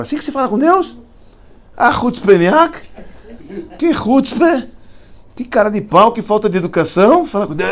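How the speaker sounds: high and loud, scandalized; outraged scolding. Retold or imitated. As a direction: scold the cheek of it. Shocked rabbi scolding the chutzpah.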